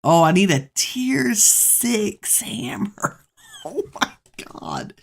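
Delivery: high, drawn-out disbelief breaking into whisper; real dismay. Real, not acted. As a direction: gasp it out, half laughing, in disbelief. Shocked by the hammer tier needed.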